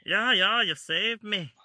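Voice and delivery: put-on high, loud Scottish accent. Friend parrots the line in a Scottish accent. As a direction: echo the line in a hammy Scottish brogue. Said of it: "you're still doing Scottish".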